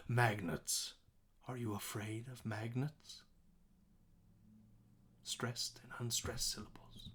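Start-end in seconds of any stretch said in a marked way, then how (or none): 5.2-7.1 whispered aside. Real, not acted.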